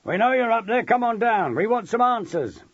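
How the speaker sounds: high, loud demand from the mob; shout. A narrator's reading. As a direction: yell it up to someone indoors. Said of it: "he heard one of them shout".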